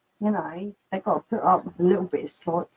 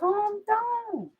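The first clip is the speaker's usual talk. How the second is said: high, drawn-out plea to settle; calling dogs down; 11 semitones higher than usual. Real, not acted.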